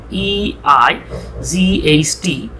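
usual speaking voice

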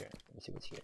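toneless whisper to yourself. Shown as a demonstration. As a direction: murmur it under your breath while searching. Whispered repeat while searching.

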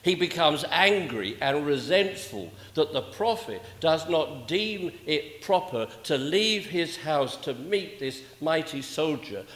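normal talking voice

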